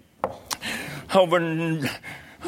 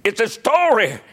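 high-pitched preacher's exclamation; excited preaching; 8 semitones higher than usual. Real, not acted. Preacher on the excitement of the story, high preaching voice. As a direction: proclaim it to the congregation with excitement.

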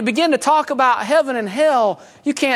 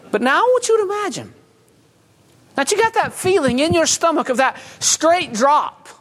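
urgent, raised preaching voice; fiery sermon build, pitched up; 3 semitones higher than usual. Acted. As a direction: paint the drop into hell for the congregation. Preaching about hell.

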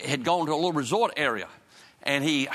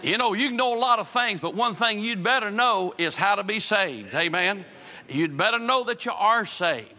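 preaching at full force; 6 semitones higher than usual. Real, not acted. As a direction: preach it hard to the congregation. Preacher drives home the point.

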